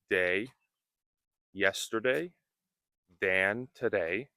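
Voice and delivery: usual speaking voice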